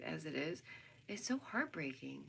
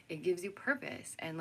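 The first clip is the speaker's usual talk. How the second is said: ordinary talk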